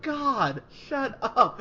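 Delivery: high, loud, laughing shut up; fed up, half laughing; exasperated laughing outburst. Retold or imitated. Annoyed viewer yelling at the screen, high laughing exasperation. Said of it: "I was just like".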